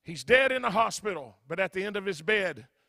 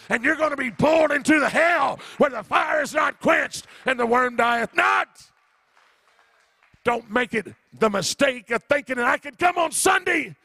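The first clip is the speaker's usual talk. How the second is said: loud, higher, pounding revival preaching; preaching at full force; 6 semitones higher, 9 dB louder than usual. Acted. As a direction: shout them out of the fire, fighting for souls.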